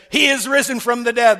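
loud, high-pitched shout; full-voiced triumphant announcement; exultant proclamation. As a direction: cry out the great news to the room. Mary proclaims news to disciples.

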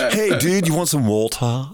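high-pitched imitated kid, American slang in British vowels; mixed-up Anglo-American accent. Father imitates his mixed-up son, muddled Anglo-American accent. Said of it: "he was all over the shop".

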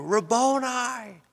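high, drawn-out cry of recognition; astonished, elongated exclamation; joyful recognition. A narrator's reading. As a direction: call out slowly, pitched high, on recognizing him. Mary recognises Jesus, calls out.

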